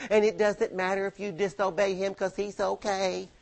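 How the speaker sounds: softly mock the sugary slogans about God; a sing-song sneer at greeting-card faith; preacher softly mocking posters that call God always nice; mocking sweet quote. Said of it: "these little things posted on people's workspaces".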